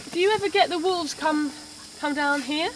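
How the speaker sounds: normal talking voice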